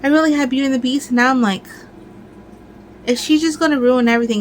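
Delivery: ordinary delivery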